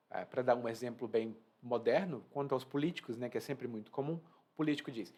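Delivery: ordinary talk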